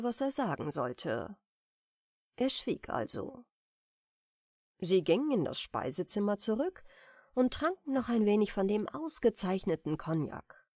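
ordinary delivery

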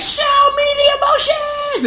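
villain girl orders the crowd, high mock shout; villain command. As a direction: demand it like a cartoon villain. Villain demands.

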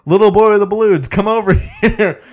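imitated high, loud yell to a kid; calling out. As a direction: call out high and loud, breaking into a laugh. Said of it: "he yells out to the kid".

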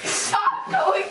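giggling through the words; laughing while pleading. Real, not acted. Arms brushing teeth, laughing.

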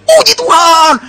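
high, loud yell of praise; mock yell. Rider spurs the galloping horse on, hammed-up high yell. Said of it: "teriak puji Tuhan".

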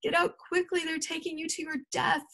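high, desperate warning; high cry of alarm; terrible cry. A narrator's reading. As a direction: plead with him to escape, pitched well up. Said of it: "cried Clover in a terrible voice".

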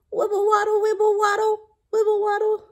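high, level sing-song chant; playful penguin waddle. Acted. Acting out how a penguin walks.

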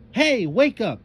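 high, loud impatient shout. A narrator's reading. Ashley wakes the sleeping DJ, shouting. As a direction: shout to rouse a heavy sleeper. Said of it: "Ashley shouted".

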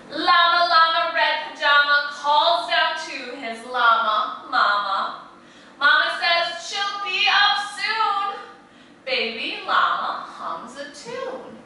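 sing-song picture-book rhythm; animated read-aloud to a class; reading to children. A narrator's reading. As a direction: lilt it like a bedtime story for little ones. Reads rhyming picture book aloud.

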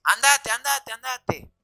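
high-pitched, urgent mock dismissal; repeated high plea to leave; imitated ex. Imitates ex sending him away.

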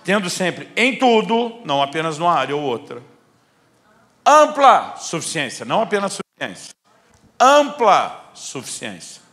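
loud, high preaching with slow weighty ampla; emphatic preaching. Acted. Pastor drives home the verse to the church, emphatic. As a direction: lean hard on ampla suficiência.

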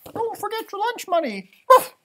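squeaky helpful pet; dog's voice. Pretend.

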